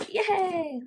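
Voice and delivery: high, drawn-out "yay"; gleeful little celebration; delighted cheer. Real, not acted. Cheering as she gets her ice cream.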